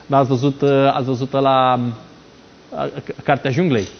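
usual speaking voice

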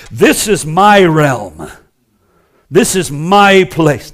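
slow, loud mock territorial claim; possessive deacon. Deacon refusing the elders' direction, possessively loud. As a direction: stake the claim with slow, booming emphasis.

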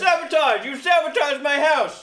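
high, loud outraged shout; loud accusation. Real, not acted. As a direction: yell that he was sabotaged. His house was knocked over.

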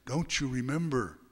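ordinary delivery

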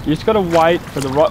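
ordinary delivery

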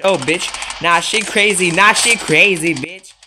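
alarmed yelling. Real, not acted. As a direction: holler in alarm at the attacker. Alarmed by an attacking player.

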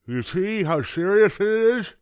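high, loud gummy moan; moaning toothless grandpa. A narrator's reading. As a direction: whine it like a toothless old man. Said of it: "moaned Grandpa".

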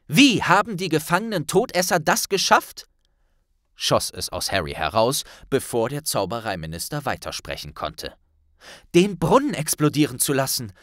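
a hint of agitated young man; Harry interrupting the minister, agitated; urgent blurting. A narrator's reading.

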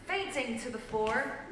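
ordinary delivery, nothing marked

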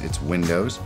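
normal talking voice

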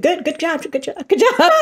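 high, loud mock praise, breaking up; praise through laughter. Instructor praising the laughing group, imitated through laughter. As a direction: imitate a cheery instructor while starting to laugh.

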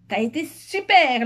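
high, loud, stretched-out admiration; called-out praise. A narrator's reading. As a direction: cheer it out, lingering on the praise. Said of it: "lança Frédéric".

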